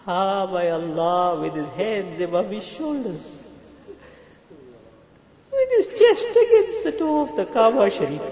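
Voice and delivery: high, drawn-out sobbing cry; weeping supplication. Real, not acted. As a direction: wail it in broken, tearful phrases. Weeps describing the Prophet praying.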